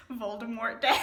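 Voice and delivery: real laughter through the words; laughing. Real, not acted. Reader laughing at the silly holiday name. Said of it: "I can't breathe".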